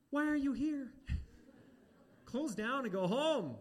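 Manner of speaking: high, loud mock exasperation, slowing on the end; exasperated outburst. Preacher mocking his own outburst at the lemonade seller, high and loud. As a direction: throw up your hands at the absurd stand.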